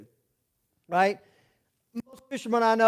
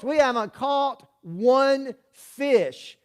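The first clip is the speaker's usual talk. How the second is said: slow admission; 4 semitones higher, much slower than usual. Retold or imitated.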